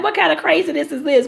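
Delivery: mock outrage at wet shoes; disbelieving exclamation. Stepped in cold water, exclaims.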